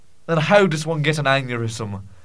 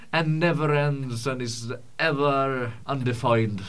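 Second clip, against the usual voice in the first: ordinary delivery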